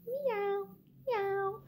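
high, soft little meow; soft kitty meow. Acted. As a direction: meow gently like a sleepy cat. Cat meows softly.

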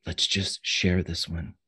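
low, hushed confidential murmur; soft whisper in the ear. Acted. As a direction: speak softly, as if close to the ear. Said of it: "she whispers in your ear".

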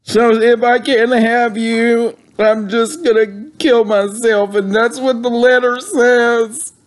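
put-on distress. Caller reading Willie a suicide note, mock distraught.

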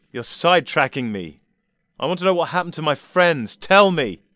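high, loud insistent demand; loud furious insistence; angry demand. Acted. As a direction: press him hard for an answer. Doctor demands answers angrily.

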